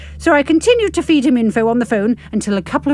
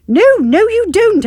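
much higher, louder scold; 11 semitones higher, 6 dB louder than usual. Acted. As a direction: push the dog off with a sharp telling-off. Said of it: "I scold".

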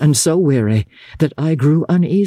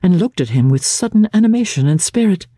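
ordinary delivery, nothing marked

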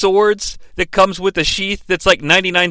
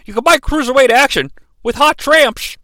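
higher, pitchman-like commentator read; quoting a commentator's cruiserweight pitch; Mark Madden quote; 8 semitones higher than usual. Retold or imitated. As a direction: sell it like a brash commentator. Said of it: "a verbatim quote from Mark Madden".

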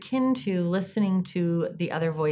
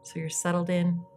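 soft spa whisper; 7 dB quieter than usual. Acted. Leads a guided meditation.

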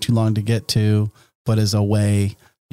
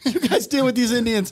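high, dismissive parting line; mock settler voice; 11 semitones higher than usual. A settler leaves the others to face danger, high and dismissive. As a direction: hand it off high and carelessly. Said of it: "settler quote continued".

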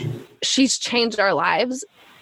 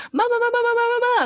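much higher sing-song calling of mama; child calling mom; faster than usual. Retold or imitated. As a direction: call out like an insistent little girl. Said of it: "she has to, like, call for me".

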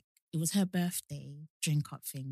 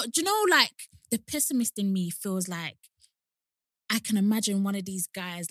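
normal talking voice

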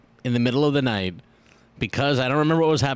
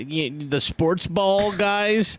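mock clueless sports fan; 8 semitones higher, slower than usual. Mocking sports ignorance.

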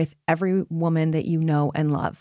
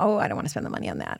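reluctant buyer; 10 semitones lower, 5 dB quieter, much faster than usual. Retold or imitated. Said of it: "you go".

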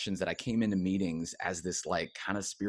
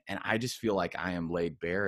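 ordinary talk, nothing marked

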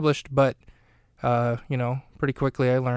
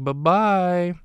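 higher, drawn-out singsong bye-bye; teasing farewell; 5 semitones higher, 4 dB louder than usual. Retold or imitated. Sisters to the villain trapped on the departing train, teasing. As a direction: toss off a cheeky, singsong goodbye.

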